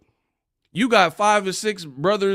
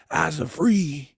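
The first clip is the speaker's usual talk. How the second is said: ordinary talk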